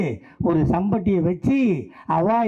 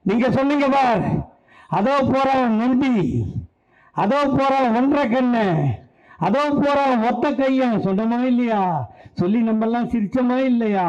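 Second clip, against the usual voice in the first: raised platform voice; rousing accusation; 6 semitones higher than usual. Acted. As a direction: challenge the crowd with their own jeers. Accuses crowd, mimicking their jeering calls.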